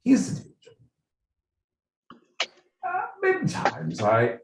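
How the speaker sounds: put-on royal British accent. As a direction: put on a regal British accent, pausing between phrases. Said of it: "in the royal British accent".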